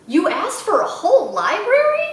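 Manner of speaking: can't believe she asked for a whole library; amazed. Acted.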